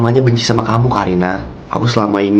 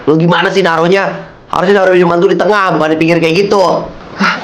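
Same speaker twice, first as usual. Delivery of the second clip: higher, sharp scolding of a clumsy helper; annoyed scolding; 7 semitones higher than usual. Acted. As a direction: snap at her for misplacing the drink. Steph scolds Karina over drink. (omelan kesal dengan nada tinggi)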